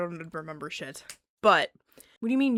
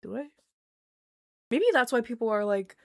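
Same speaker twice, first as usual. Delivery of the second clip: usual speaking voice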